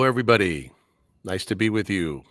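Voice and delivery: ordinary talk, nothing marked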